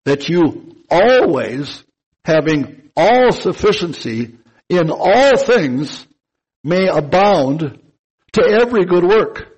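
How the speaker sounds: building sermon emphasis; slow emphatic reading. Shown as a demonstration. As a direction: drive every phrase home, slowly. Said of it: "I'm gonna emphasize some words here".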